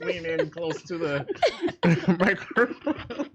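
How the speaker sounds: slow, breaking into laughter; hammed-up ASMR invitation, drawn out; slow mock ASMR voice with a laugh. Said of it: "get some gum chewing A S M R going on".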